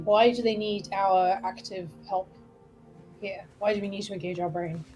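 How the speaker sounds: usual speaking voice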